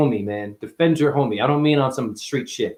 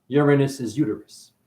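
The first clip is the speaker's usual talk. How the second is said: ordinary talk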